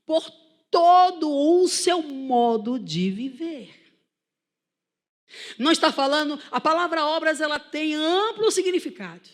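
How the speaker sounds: emphatic, high-energy sermon delivery; forceful preaching. Real, not acted. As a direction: proclaim it to the whole congregation.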